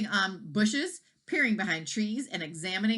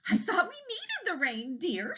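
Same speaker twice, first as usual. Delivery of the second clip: much higher, gentle wife's voice; 12 semitones higher than usual. A narrator's reading. Wife soothing her husband, higher gentle voice. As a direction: lift it into the wife's voice.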